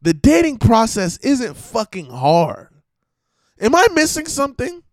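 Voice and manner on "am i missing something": ordinary talk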